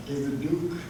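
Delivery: ordinary talk, nothing marked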